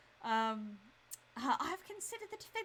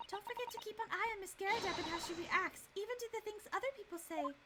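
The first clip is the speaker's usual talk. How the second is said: higher, softer assistant voice, earnest; young female assistant; 10 semitones higher, 3 dB quieter, faster than usual. Acted. Assistant urging the defense lawyer, higher and soft. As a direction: gently warn the lawyer what he must do.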